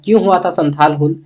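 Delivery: usual speaking voice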